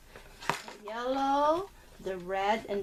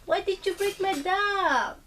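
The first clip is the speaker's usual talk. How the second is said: higher, pretend-hurt question to a child; playful mock complaint; 9 semitones higher than usual. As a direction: tease him, all fake upset. Mom mock complains to son.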